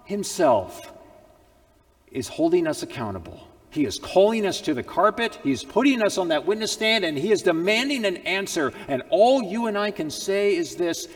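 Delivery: loud, high, driving sermon voice; thundering preacher warning of judgment; full-force preaching. Acted. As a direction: preach it hard from the pulpit. Preacher builds to a climax.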